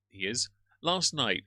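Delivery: ordinary talk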